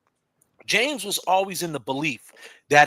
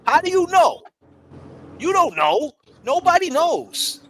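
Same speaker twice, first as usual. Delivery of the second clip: ranting; 11 semitones higher, 3 dB louder than usual. Acted. Ranting at Gladys.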